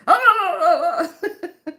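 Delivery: high burst of laughter; loud laugh. Real, not acted. Laughing after impression.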